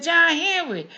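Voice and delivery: calling loudly across the street; hollering out. Pretend. Old lady hollers out her window at neighbor John Henry, loud call. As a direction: shout his name out the window.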